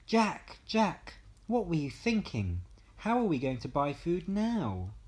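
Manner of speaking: loud, high, exasperated calling of Jack's name; angry scolding. A narrator's reading. Mother scolding Jack over lost cow, angry and loud. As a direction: berate him, near a shout.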